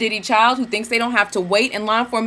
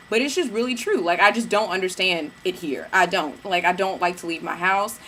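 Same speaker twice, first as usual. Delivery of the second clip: ordinary talk, nothing marked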